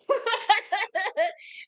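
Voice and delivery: a high burst of laughter. Real, not acted. Laughing at her own distraction, a high burst of laughter.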